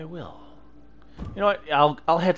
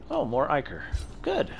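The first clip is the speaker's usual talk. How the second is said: normal talking voice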